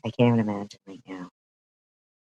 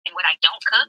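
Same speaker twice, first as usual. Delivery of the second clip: mocking imitation; 6 semitones higher, 4 dB louder, faster than usual. Mocking the woman's words.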